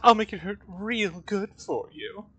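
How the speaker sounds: hammed-up sultry villainess; Veronica drag queen voice. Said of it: "doing more of a drag queen voice for Veronica".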